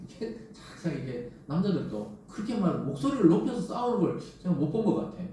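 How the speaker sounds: usual speaking voice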